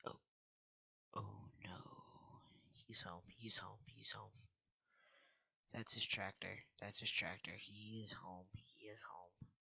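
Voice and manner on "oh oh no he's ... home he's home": whispering. Real, not acted. Sneaking near owner's home.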